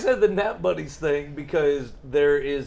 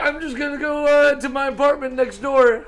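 higher, laughing fake excuse; mock excuse; 7 semitones higher, 7 dB louder than usual. Friend slipping off to nap, mock casual. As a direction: sell the excuse with a grin. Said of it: "one of them's like".

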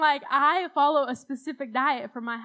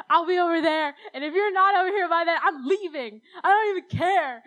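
higher and louder mock yell; retold yell; 5 semitones higher, 4 dB louder than usual. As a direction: snap at mom, half-playing it up. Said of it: "I'm, like, screaming at her".

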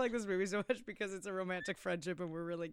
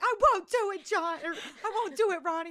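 pleading actress; 10 semitones higher, 4 dB louder than usual. Retold or imitated. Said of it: "she's like".

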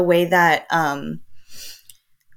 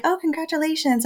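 high sing-song congratulations; bright greeting; chirpy stranger; 10 semitones higher than usual. Retold or imitated. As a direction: mimic cheerful strangers. Strangers voiced.

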